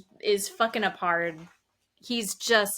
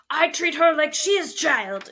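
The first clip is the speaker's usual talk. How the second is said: loud, higher retold yelling; mock scream; 6 semitones higher, 6 dB louder than usual. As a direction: imitate a man yelling in protest. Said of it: "he's screaming at them".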